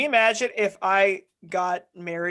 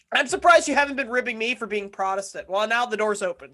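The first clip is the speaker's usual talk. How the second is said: ordinary talk, nothing marked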